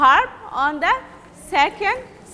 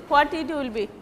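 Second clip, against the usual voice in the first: ordinary delivery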